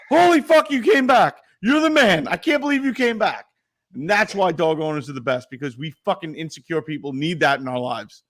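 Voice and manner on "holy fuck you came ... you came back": much higher, louder, overjoyed; the dog losing its mind with joy; excited dog. Retold or imitated. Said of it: "My dog's like".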